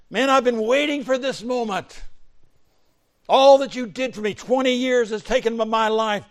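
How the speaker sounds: high, very loud shouting; acted angry tirade. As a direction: let years of grievance explode. Imagined vengeful Joseph rants at brothers.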